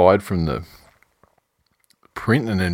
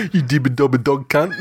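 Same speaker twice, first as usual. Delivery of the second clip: put-on laughing Kiwi accent, higher; 5 semitones higher than usual. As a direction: blurt it in a giggly Kiwi accent. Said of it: "why it was Kiwi accent in there".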